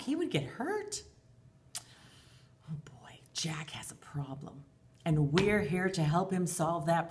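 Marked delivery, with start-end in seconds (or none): 2.7-4.6 hushed worried aside; whisper. Acted. Teacher whispering to the children about Jack's trouble. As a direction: whisper it to the kids like a secret.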